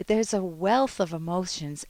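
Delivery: ordinary talk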